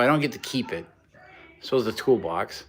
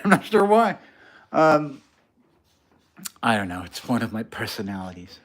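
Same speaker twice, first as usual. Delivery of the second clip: ordinary delivery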